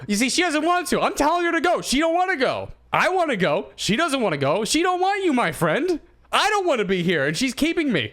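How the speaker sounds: put-on the old man protesting he's kept there; mock old man complaining. Still voicing the old man.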